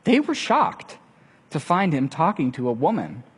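ordinary talk

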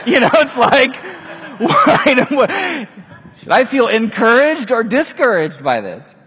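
high, loud, laughing question; laughing disbelief. Real, not acted. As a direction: laugh it off, half baffled. Laughing at the odd promise.

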